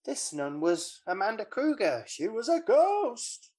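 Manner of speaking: mock shock. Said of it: "then he goes".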